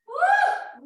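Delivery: excited woo. Real, not acted. Energetic cheer mid-workout.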